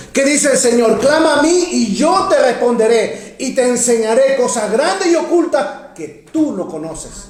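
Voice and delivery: high-pitched scripture proclamation; ringing, stretched-out pulpit delivery; fervent preaching. Real, not acted. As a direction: proclaim the verse to the whole church.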